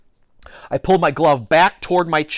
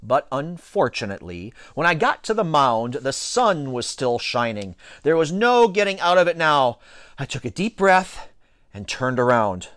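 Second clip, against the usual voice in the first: ordinary delivery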